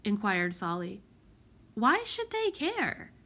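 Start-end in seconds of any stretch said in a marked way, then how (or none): 1.7-3.1 shrugging. A narrator's reading. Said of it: "said Prudence with a shrug".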